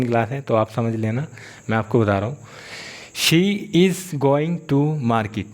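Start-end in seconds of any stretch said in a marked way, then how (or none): 3.2-5.4 very slow, word by word for students; teacher dictating a model sentence very slowly; slow dictation. Shown as a demonstration. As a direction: spell it out slowly so learners can follow. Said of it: "slow dictation of example".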